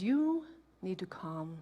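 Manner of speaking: slow, soft calming voice; calm woman. Retold or imitated. Peaceful stranger telling frantic mother to calm down. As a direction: settle her down gently. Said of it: "one of the peaceful with her beads".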